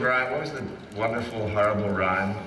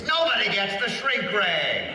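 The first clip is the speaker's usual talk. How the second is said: higher, slow villain line; character catchphrase; 7 semitones higher, slower than usual. Acted. Actor repeats his favorite line while driving, hammed-up. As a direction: savor the catchphrase. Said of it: "the one I find myself repeating".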